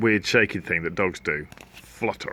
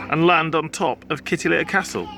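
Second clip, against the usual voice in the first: ordinary delivery, nothing marked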